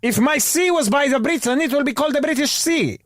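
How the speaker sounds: put-on very high quavering old woman; high mock old woman; old granny proverb voice. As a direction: croak out folk wisdom like a granny. Granny voice again.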